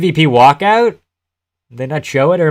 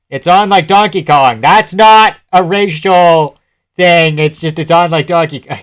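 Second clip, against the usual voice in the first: excited; 4 semitones higher, 6 dB louder than usual. Real, not acted. Hyped as fight begins.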